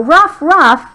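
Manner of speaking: lightly voiced high dog bark. Teacher adding the dog's words to the story, light barking.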